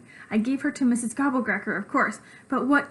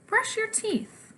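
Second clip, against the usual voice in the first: mom voice; 10 semitones higher, slower than usual. Acted. Mom's bedtime order.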